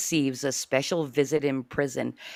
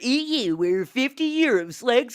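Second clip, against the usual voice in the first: TV announcer; 9 semitones higher, 8 dB louder than usual. Acted. TV show announcer intro.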